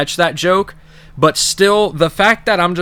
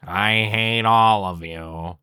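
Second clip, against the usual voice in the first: low, soft, slow bitter grumble; Squidward grumble; 5 semitones lower, 4 dB quieter, much slower than usual. Retold or imitated. Squidward sneering at everyone, slow low grumble. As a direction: drag it out with weary disgust.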